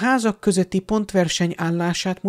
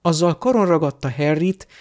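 ordinary delivery, nothing marked

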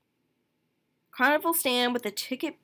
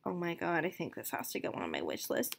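ordinary talk

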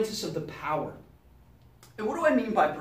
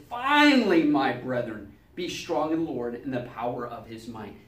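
scripture reading; 7 semitones lower than usual. Acted. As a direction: lay the verse down with weight.

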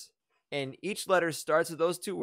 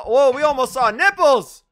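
loud, high-pitched amazed outcry; excited exclamation; 9 semitones higher, 11 dB louder than usual. Real, not acted. Host to the cast after the near slip on stream, excited. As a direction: blurt it out in scandalized excitement.

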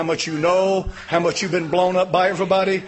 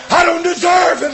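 high, loud, shouted I don't deserve it; shouted plea; 12 semitones higher, 11 dB louder than usual. Real, not acted. Preacher voicing David's plea to God, shouted. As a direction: roar the plea at full force.

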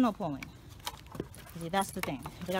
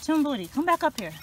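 calling out; 7 semitones higher, 6 dB louder than usual. Real, not acted. Calling dog back.

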